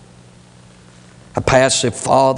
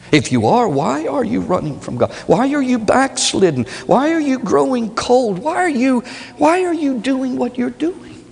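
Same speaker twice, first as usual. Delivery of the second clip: high, rising pulpit questions; preaching at full force; 12 semitones higher than usual. Acted. Preacher pleading with backslidden listeners, higher. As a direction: press each question higher.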